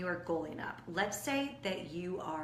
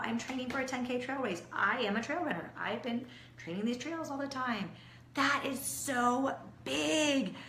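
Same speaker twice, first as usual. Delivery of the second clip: ordinary talk, nothing marked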